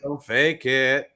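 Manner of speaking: slow, low voiceover tagline; mock ad voice. Cohost echoing the ad tagline, low and slow. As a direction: echo the slogan in a deep ad voice. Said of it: "That's a real commercial".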